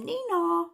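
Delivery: high, loud two-note nee-naw; sing-song siren noise; police siren. A narrator's reading. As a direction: imitate a police car siren. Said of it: "called the cops and they came".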